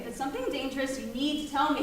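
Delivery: loud, firm demand; insistent shout. Acted. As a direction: lay down the law. Mother insists during argument.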